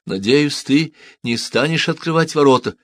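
a wife warns her husband from the yard; woman's voice. A narrator's reading. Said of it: "раздался и женский голос".

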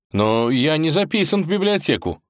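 object, at a loss; confused. A narrator's reading. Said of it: "растерянно пробормотал Иван".

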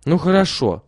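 put-on girl agrees. A narrator's reading. Lilya agrees to join her brothers, grudgingly. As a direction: give in, a bit grudgingly. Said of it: "отвечала Лиля".

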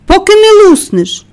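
high, loud angry tirade; furious shouting. A narrator's reading. Mary screaming back at Colin in his tantrum, furious shout. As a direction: yell the boy down. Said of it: "з цілої сили крикнула вона".

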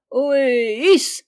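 drawn-out heave-ho cry; sailors' hauling call. A narrator's reading. Fanta hauls on the well rope, crying like sailors. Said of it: "en criant, comme font les marins".

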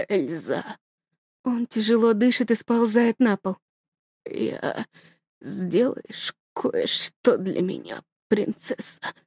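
father gasping last orders to daughter; dying whisper. Acted. (тихо, шёпотом, умирая)

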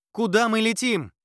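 loud high shouted question; shouting over wind. A narrator's reading. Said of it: "пытаясь перекричать ветер".

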